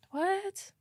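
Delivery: high, small baffled what; lost in the story, squeak it. Real, not acted. Reading a confusing story, a high baffled squeak.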